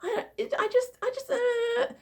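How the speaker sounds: put-on very high, whiny girly voice; girly nasal voice. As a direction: imitate a cutesy girly voice. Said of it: "I'm gonna try to imitate it".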